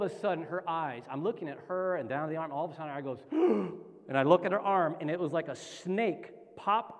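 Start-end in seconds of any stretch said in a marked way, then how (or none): 3.2-3.8 sharp sucked-in gasp; imitated gasp. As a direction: act out a sudden gasp. Acting the sudden reaction.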